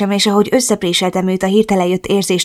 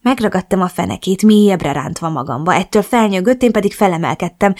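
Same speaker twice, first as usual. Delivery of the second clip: ordinary delivery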